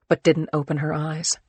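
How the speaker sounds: usual speaking voice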